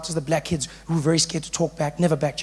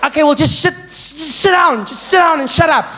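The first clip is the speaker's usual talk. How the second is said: high, loud giving-in order; exasperated teacher; 10 semitones higher, 7 dB louder than usual. Acted. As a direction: back down while still shouting.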